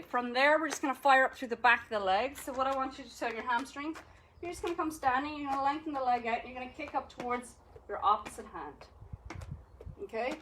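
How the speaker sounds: ordinary talk, nothing marked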